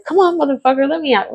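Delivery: high-pitched, impatient begging; retold exasperated plea. Calling the P O from jail to be released, high exasperated plea. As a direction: plead high, re-enacting the frustration.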